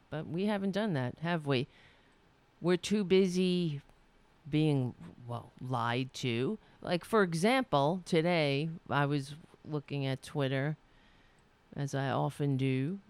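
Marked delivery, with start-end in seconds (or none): none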